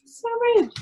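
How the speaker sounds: high playful disapproval; mock scandalized. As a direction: pretend to be shocked for the kids. Reacting to greedy ferret picture.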